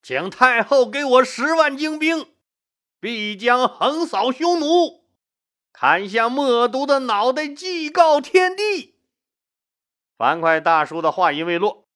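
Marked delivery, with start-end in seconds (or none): 0.0-8.9 high-pitched heroic proclamation; loud battle vow. A narrator's reading. General begging the empress for an army, loud battle vow. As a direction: proclaim it boldly, rising to a drawn-out climax. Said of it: "樊哙大喊".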